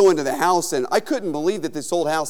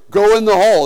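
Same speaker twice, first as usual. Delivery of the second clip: loud, higher-pitched command; imitated order; 4 semitones higher, 8 dB louder than usual. Haunted-house worker ordering a visitor into the hole, mock loud command.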